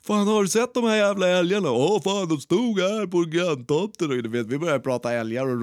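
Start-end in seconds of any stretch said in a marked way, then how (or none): 0.0-4.1 high, loud yard call across to the neighbour. Retold or imitated. Calling across the yard to the neighbour, loud high shout. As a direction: holler over twenty metres to Fredde. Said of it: "börjar ro".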